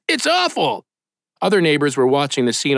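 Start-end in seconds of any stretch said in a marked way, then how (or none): 0.1-0.8 yelled. A narrator's reading. Neighbors yelling at scene.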